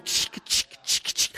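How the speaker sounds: soft unvoiced chick chick noise; quiet hissing spurt sounds; imitated squirting blood. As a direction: mimic blood spurting from the ear. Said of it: "the guy who's going".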